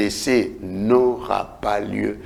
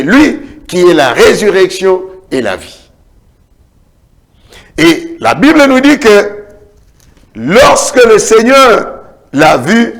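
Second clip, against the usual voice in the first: high, loud preacher's cry rising and falling; fiery preaching; 7 semitones higher, 8 dB louder than usual. Acted. Pastor telling grieving believers Jesus says do not weep, fiery preaching. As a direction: preach it with full force to the congregation.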